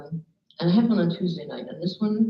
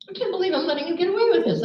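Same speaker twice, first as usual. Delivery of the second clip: fast, much higher imitated outburst; high-pitched disbelief; 12 semitones higher, faster than usual. Woman after her outburst, high and incredulous. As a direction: rattle it off high, as if amazed at yourself.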